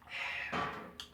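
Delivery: long soft exhale; quiet breathy sigh; weary sigh. Real, not acted. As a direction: let out a slow sigh. Pause after spilling a drink.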